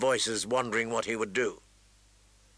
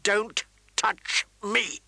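slow, higher, scornful snarl; spat contempt; 7 semitones higher, much slower than usual. Acted. Wounded prince spitting contempt at Sansa, slowly. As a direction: spit each word out with loathing. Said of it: "he spit at her".

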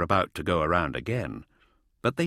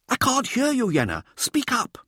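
high, demanding showboater; showboating Mirza; 5 dB louder than usual. A narrator's reading. Showboater tells a mumbling junior to speak up, high and demanding. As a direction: pitch it high and call out the mumbler. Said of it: "end Mirza's showboating".

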